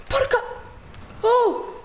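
startled cry. Real, not acted. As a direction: jump out of your skin. Spaventato improvvisamente nel gioco. (grido di spavento acuto)

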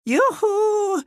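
sing-out, high villainess call. Acted. Sea witch hailing someone for a little chat, high calling. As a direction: call out high, holding the last syllable.

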